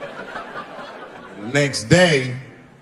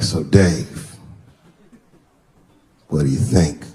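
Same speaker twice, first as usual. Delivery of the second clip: lower, lightly voiced friend; friend's voice; 11 semitones lower, slower than usual. Buddy introduces fiance to Dave, lower voice. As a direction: drop the pitch for the buddy.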